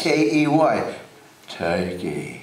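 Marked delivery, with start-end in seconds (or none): 1.5-2.4 low Upper East Side New York accent; old Upper East Side accent, "Turkey" as "tay-kee"; Harpo. Shown as a demonstration. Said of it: "an Upper East Side accent".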